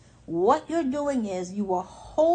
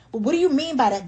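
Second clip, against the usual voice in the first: pushy demand; 4 semitones higher, 7 dB louder, faster than usual. Retold or imitated. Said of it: "Don't explain what you mean".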